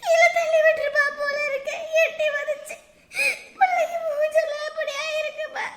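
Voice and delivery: tearful wail. Acted. Mother telling how the old woman hurt her child, crying. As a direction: wail through the tears. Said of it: "ஐயோ அண்ணி, அழாதீங்க".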